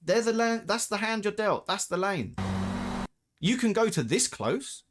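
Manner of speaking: rising outrage; heated rant. Real, not acted. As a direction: lecture the bad driver, worked up.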